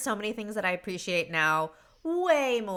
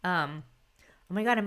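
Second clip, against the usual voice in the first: ordinary talk, nothing marked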